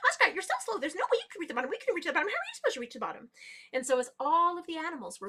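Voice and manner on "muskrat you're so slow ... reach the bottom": high giggle into rapid, high jeering; giggle, then rattle off the taunts; laughing mockery. A narrator's reading. Fastest swimmers laugh at muskrat, high mocking chatter. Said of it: "fastest swimmers started laughing at her".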